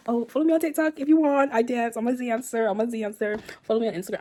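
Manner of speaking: high, loud call to follow, easing down slightly; loud plug. Real, not acted. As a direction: call it out to the viewers. Plugging her socials after signing off.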